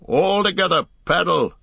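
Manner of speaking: high rallying shout. A narrator's reading. Boromir urges the paddlers on, shouting. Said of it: "shouted Boromir".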